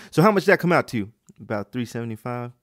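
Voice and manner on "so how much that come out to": high, loud mock shopper; loud obnoxious customer. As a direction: demand it noisily as the customer. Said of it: "be that loud and obnoxious".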